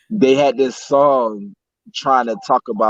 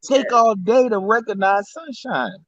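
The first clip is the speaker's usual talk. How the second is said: high, laughing repeat of a favourite line; giggling delivery; 12 semitones higher than usual. Real, not acted. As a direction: crack up while quoting it.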